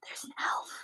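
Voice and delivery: very quiet, voiceless whisper. Acted. Boy whispers down to the player about an elf, whispering. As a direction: whisper it down like a secret. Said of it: "he whispers down to you".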